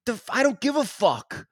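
high-pitched retold outrage; mock angry dismissal. Neighbour rejects the diggers' excuse, mock angry. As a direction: imitate a neighbour fed up with excuses.

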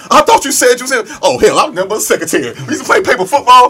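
very high, loud mock argument; acted rapid-fire bellowing; quarrelsome shouting. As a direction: yell it fast and hammed-up, arguing back. Acted quarrel, voices shouting back.

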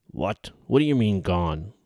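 sharp, loud, disbelieving; angry outburst. Acted. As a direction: snap it out in disbelief. Commander hears satchel is gone.

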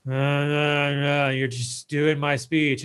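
slow, low mock monotone of a lifeless presenter; a hint of a bored robot speaker, hesitant uhs; dead-eyed speech reader. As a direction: drone it like a robot reading the script. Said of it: "your eyes are dead inside".

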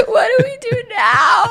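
loud, very high tearful wail; hammed-up sobbing squeal breaking into laughter; mock crying. She asks him what happens next, mock crying wail. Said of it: "I start crying".

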